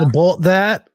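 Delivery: teasing Minnesota "about that" with a drawn-out o; mock Minnesota accent. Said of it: "Are you making fun of my Minnesota accent?".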